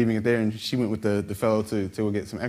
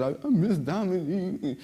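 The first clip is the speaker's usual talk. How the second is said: higher voice breaking into tears; crying; 9 semitones higher than usual. Retold or imitated. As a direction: say it through a real sob. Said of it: "I'm crying into her shoulder".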